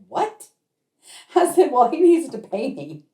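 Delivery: high-pitched mock disbelief; a sharply rising, astonished question; retold shock. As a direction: react as if floored by the news. Her retold shock at the debt news.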